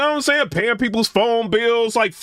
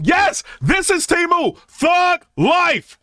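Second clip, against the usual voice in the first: hyped shout; 5 semitones higher, slower than usual. Pretend. Loud hyped brag about Temu.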